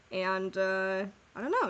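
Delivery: usual speaking voice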